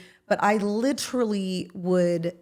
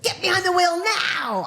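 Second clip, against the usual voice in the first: much higher mock order; higher imitation of a bossy friend; bossy Gwen command; 10 semitones higher, 3 dB louder than usual. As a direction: order her like a pushy friend. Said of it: "She's like".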